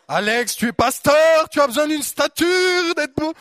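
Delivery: high, loud pompous voice; mock-proud self-talk. Preacher teasing himself as a vain pastor, high loud. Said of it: "Oh, on se calme".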